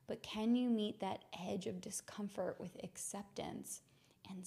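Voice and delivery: soft, meditative guidance; hushed, settled voice with pauses between phrases; guided reflection. Real, not acted. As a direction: gently lead listeners inward to acceptance. Leads a reflective practice.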